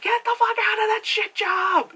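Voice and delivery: high-pitched exasperated yell; urgent mental scream. Acted. Brain to herself about a retail job, high frantic yell. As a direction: shriek it, strained and high.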